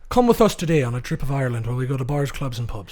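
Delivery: put-on playful Irish accent. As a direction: pitch a pub tour in an Irish accent. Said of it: "you guys are good at Irish".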